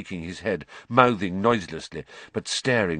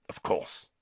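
breathy whispered reply; 9 dB quieter than usual. Acted. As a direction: whisper it gently.

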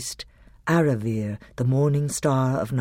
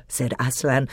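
plain reading voice

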